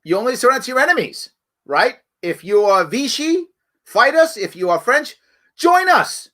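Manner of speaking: retold shout, rising high on fight us and join us; half-shouted call to join up; mock yell. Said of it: "He yells".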